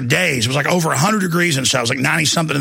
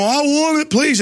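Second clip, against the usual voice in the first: begging prisoner; 8 semitones higher than usual. Retold or imitated. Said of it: "begging for the food".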